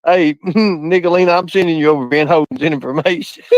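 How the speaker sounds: ordinary delivery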